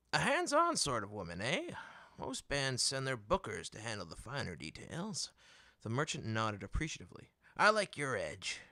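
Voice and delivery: a salesman who smells a sale; eager merchant. Acted.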